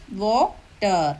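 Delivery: വാട്ടർ slowly broken as വാ, pause, ട്ടർ; വാട്ടർ with a clear gap before ട്ടർ; split into two syllables. Shown as a demonstration. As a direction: say വാട്ടർ in two separate beats. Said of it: "രണ്ടെണ്ണം കാണും".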